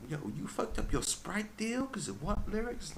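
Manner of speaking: high, slow mock outrage; mock rapper asking in disbelief; incredulous rapper voice. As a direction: drag it out in disbelief.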